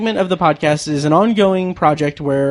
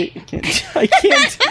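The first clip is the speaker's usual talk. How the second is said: breathless laughing I can't; laughing helplessly; slower than usual. Real, not acted. Cracking up over the accent game while laughing. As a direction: squeak it out through giggles.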